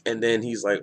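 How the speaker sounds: ordinary talk, nothing marked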